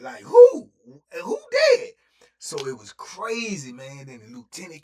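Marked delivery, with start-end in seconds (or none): 0.0-1.9 very high, loud, breaking cry; frantic to know who died; paranoid panic. Retold or imitated. Fearing for his friend, he cries out in panic. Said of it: "Now I'm really paranoid".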